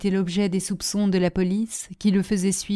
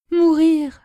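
high, loud cry of disbelief; horrified exclamation; 8 semitones higher, 7 dB louder than usual. A narrator's reading. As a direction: cry out at the thought of death. Woman horrified at lover's death. (exclamation horrifiée, aiguë et forte)

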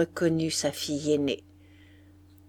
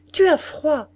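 higher, louder pouting jab; petulant complaint; 7 semitones higher, 6 dB louder than usual. A narrator's reading. Sulky daughter recoils from her mother's hand, petulant. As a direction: complain sharply about the cold touch.

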